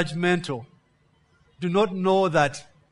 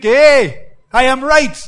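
loud, higher, triumphant Hey I am right; smug loud boast; 6 semitones higher, 9 dB louder, slower than usual. Acted. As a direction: crow it out like a proud hypocrite. Hypocrite voiced in sermon.

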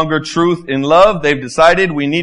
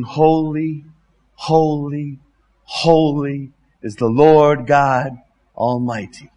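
reverent angels' hymn; much slower than usual. Retold or imitated. Quoting angels' song, slow reverent.

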